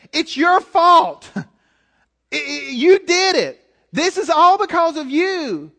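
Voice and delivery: mock accusation stripped of politeness; blunt blame. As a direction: spell out the hidden blame plainly. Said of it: "It's blame".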